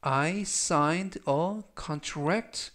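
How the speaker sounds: very slow, each word spaced out; slow read-aloud. Shown as a demonstration. Teacher slowly reading the example sentence for repetition. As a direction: drill it slowly for the class. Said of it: "천천히 세 번씩 읽어드리고요".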